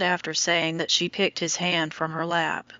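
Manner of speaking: ordinary delivery, nothing marked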